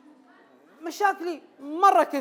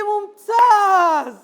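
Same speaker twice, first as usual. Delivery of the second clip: loud, high, long-drawn exclamation; hammed-up enthusiastic approval; delighted principal; 5 semitones higher, 8 dB louder, much slower than usual. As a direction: stretch it out in mock delight. New principal's delighted reply, retold.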